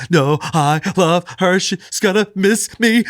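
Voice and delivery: slow, high mock-tearful child; sobbing little daughter. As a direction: drag it out like a heartbroken kid. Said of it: "She's sobbing".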